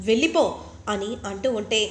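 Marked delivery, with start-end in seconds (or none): 0.1-0.4 loud, higher command to leave; commanding shout. Acted. Exorcist ordering the ghost out, loud shout.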